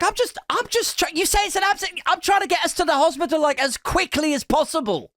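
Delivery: high-pitched, frantic protest; exasperated self-defence. Retold or imitated. Driver defending himself to sick girlfriend, exasperated. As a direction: protest in a high, stumbling rush. Acted row while driving to hospital.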